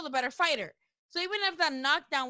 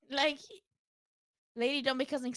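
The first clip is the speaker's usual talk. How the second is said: ordinary delivery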